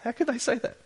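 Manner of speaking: a stunned rhetorical question; incredulous. Real, not acted. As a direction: ask it in real astonishment. Preacher reacts to the complaint.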